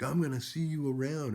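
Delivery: high, loud threatening call; mock yelled threat; retold threat. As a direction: yell a parting threat. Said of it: "and he's like".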